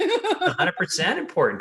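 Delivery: high, laughing emphasis; laughing agreement. Real, not acted. Host agreeing with the guest, laughing high.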